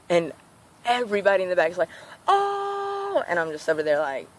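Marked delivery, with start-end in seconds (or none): 2.3-3.2 crowd oooh. Retold or imitated. Whole bus reacting to comeback.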